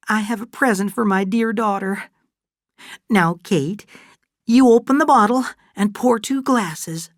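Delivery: high-pitched, festive motherly voice; Fay, doting and moved. Acted. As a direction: present a gift proudly in a high character voice.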